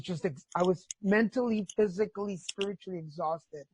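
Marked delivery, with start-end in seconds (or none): none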